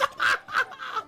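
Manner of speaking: a burst of high, shouted laughter; high laughing shout. Real, not acted. Laughing at the walk.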